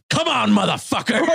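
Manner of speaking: high-pitched retold battle cry; mock challenge. Man retelling his standoff with a dog, mock-fierce challenge. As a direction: dare the dog on, playing up the fight.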